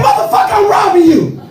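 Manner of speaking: high shouted threat; robber yell. Retold or imitated. Robber yelling at the comic in Ferguson. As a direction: scream it like a stick-up man.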